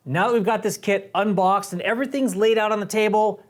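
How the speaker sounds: usual speaking voice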